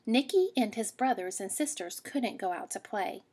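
plain reading voice

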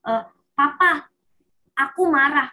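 put-on a child announcing to Papa that they are angry; child telling papa off. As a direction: call out to Papa, upset but controlled. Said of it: "dia bisa bilang".

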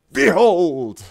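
booming high proclamation, drawn out; theatrical announcer. Acted. Streamer hails a new subscriber like a herald, booming. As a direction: announce each gift like a herald.

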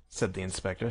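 ordinary delivery, nothing marked

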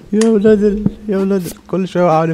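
high, strained cry of frustration; exasperated squeal. Real, not acted. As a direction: squeak the curse out in despair. Frustrated cursing at the game. (صرخة رفيعة من الغيظ)

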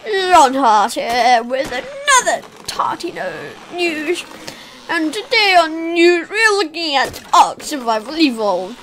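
loud, high, hammed-up English presenter accent; fake English accent. Player mock-presenting game news, in fake English accent. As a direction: put on an over-the-top English accent, swooping up on the praise. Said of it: "putting on this fake English accent".

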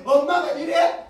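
laughing, drawn-out shout of mock disbelief; mocking son shout. 엄마에게 비웃듯 소리치는 아들. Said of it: "그럼 얘기 또 뭐라 그래?".

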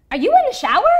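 high, loud startled question; surprised question. Retold or imitated. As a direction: ask it in loud disbelief. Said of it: "And she goes".

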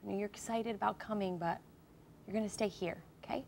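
put-on hushed and coaxing, like calming a toddler; soft talk to a small child. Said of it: "talk to him like a four-year-old".

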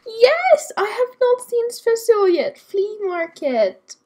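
high, loud burst of delight; real excitement. Real, not acted. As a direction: exclaim it, thrilled at the find. Delighted to find an unseen festival.

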